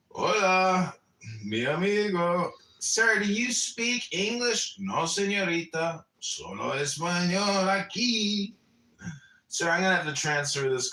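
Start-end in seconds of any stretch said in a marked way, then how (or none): 0.1-8.5 lightly hammed Spanish-accented caller, higher. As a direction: play a Spanish-only caller, pitched up.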